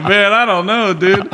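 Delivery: high sheepish I-don't-know voice; clueless shrug. Retold or imitated. Answering angry instructors sheepishly.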